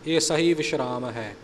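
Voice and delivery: usual speaking voice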